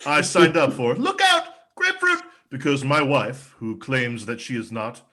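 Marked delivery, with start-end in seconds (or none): 1.0-2.2 high, sudden yelled product name; called-out warning. Acted. Comic pitching Look Out Grapefruit to audience, mock warning shout. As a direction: cry it out like an alarm.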